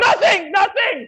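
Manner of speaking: high, loud denial; panicked screaming. Acted. Man insisting to companion nothing's the matter, panicked screaming. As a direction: yell it out in a panic.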